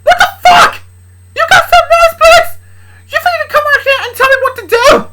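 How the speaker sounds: very high screaming fury; angry. Acted. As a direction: scream back at your dad, full force. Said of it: "Luna said angrily".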